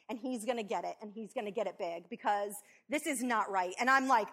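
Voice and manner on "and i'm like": ordinary talk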